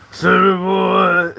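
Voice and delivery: high, slow and loud call of the name; stretched-out yell. Acted. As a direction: hold the name out in a long shout. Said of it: "Why are you screaming?".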